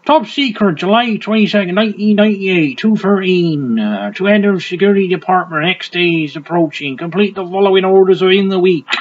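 high-pitched West Country read-out; West Country accent. Acted. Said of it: "could do it in a West Country accent".

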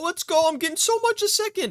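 high, thrilled burst; excited cheer. Real, not acted. Player pumping iron celebrates his gains, excited cheer.

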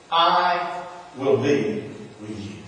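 the Lord answering the preacher, soft and slow; soft reassurance. Acted. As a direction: let it land quietly, like a comfort. The Lord's answer voiced.

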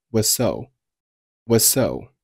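"was so" slow, sharing a single s; dropped z sound. Shown as a demonstration. Teacher slowly showing learners "was so" with one s. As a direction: merge the end of "was" into the s of "so". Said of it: "was结尾的z不发音".

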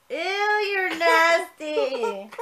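slow, loud teasing ew; playful disgust. Real, not acted. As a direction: stretch it out, teasing and grossed out. Teasing toddler blowing bubbles.

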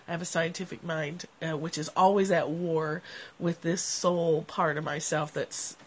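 normal talking voice

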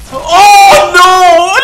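loud, high cry of dismay; alarmed shout. Real, not acted. Gamer reacting to danger, alarmed shout.

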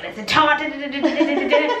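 excited gushing. Retold or imitated. Excitedly retelling own gushing.

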